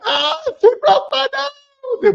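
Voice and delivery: very high, slow crying whine; mock wailing. Injured dancer whining about her nose, mock crying. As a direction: wail it in a high mock cry.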